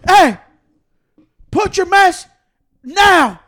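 loud, high imitation of a scolding woman; yelling lady. Imitating the lady ordering him to mask up, yelling. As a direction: bark each piece as a separate order. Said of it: "the lady keeps yelling at them".

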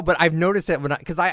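ordinary talk, nothing marked

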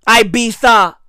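"Ibiza" as eye-BEE-tha; lisped TH Ibiza. Shown as a demonstration. Comic repeating his choice, "Ibiza" with TH. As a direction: say "Ibiza" with th in place of z. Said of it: "pronounce it with a tha".

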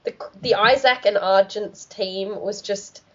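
ordinary delivery

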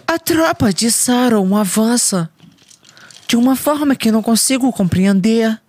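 high, dramatic hobbit voice; hammed-up Frodo dub line; epic fantasy redub voice. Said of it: "já viu essa redublagem".

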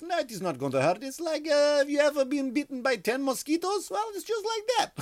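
high-pitched mock Russian woman; Russian accent. Russian nurse comforting a boy before blood test, Russian accent. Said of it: "with a Russian accent".

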